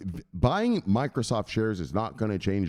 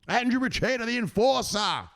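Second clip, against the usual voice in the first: hype announcer. Acted. Host presenting Andrew the enforcer, hyped announcer. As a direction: stretch the title out high and big.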